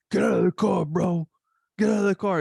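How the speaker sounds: hammed-up road-rage holler; retold scream. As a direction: imitate an angry driver shouting orders. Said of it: "he's screaming at me".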